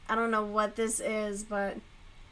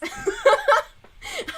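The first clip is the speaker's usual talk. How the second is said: high, loud burst of laughter; 10 dB louder than usual. Real, not acted. Laughing at her own air quotes, a loud high laugh.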